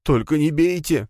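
high, whining begging; whining plea. Acted. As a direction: whine like a scared servant. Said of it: "законючил он".

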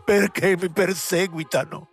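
starts slow, climbs high into a desperate shout; a ruined actor crying out to the world; anguished cry. A narrator's reading. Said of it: "gridò".

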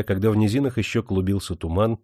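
ordinary delivery, nothing marked

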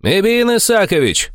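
shouted question across a distance; calling out. A narrator's reading. Armed newcomer to his boss, calling out. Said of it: "крикнул один из новоприбывших".

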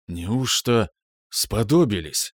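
slow, hoarse and strained; stunned, voice sat down with shock. A narrator's reading. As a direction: rasp it out slowly, voice gone. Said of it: "Я не узнал свой голос, до того он сел".

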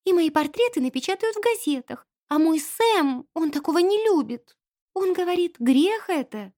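high, plaintive maid's voice; trembling with fright. A narrator's reading. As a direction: lament anxiously in a high voice. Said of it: "воскликнула она вся дрожа". (испуганно, высоким жалобным голосом)

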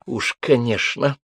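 sour, drawn-out sniff of contempt; angry snort. A narrator's reading. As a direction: stretch it out with scornful spite. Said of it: "зло фыркнула Римма".

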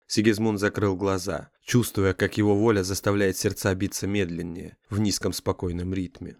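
usual speaking voice

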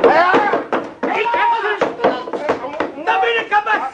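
exultant yell as they grab him; triumphant shout. Acted. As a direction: crow over the catch. Ambush, seizing the intruder.

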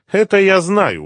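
high, loud smirking reply. A narrator's reading. Gangster unimpressed by captive's information, smirking. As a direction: brush it off with a knowing lift. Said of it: "ухмыльнулся Митя".

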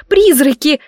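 высокий громкий оклик; выкрикни, будто зовёшь духов; крик. A narrator's reading. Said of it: "закричала Бри". (крик, призыв)

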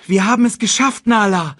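high, loud cry of relief; jubilant shout. A narrator's reading. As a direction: call out in triumph. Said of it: "rief er".

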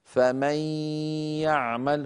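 "فمن يعمل" with the noon merged into a long nasal ya; ghunnah merging. Shown as a demonstration. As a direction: draw out the nasal hum joining "فمن" to "يعمل". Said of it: "بل طولناه".

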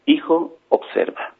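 soft, hushed fatherly voice; dropping almost to a whisper; tender father. A narrator's reading. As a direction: gently draw the child's attention. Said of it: "acarició al niño y le dijo".

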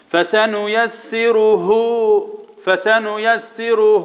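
slow chant with a long "hoo" ending فسنيسره; intoned فسنيسره, last vowel fully lengthened; saturated haa vowel. Shown as a demonstration. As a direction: draw out the final haa of فسنيسره into a full u. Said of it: "يبقى نشبع ايه".

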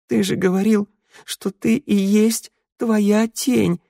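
high, slowing plaintive reproach; tearful. A narrator's reading. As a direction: plead on the edge of tears. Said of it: "продолжила Санда плачущим голосом". (жалобно, плачущим голосом)

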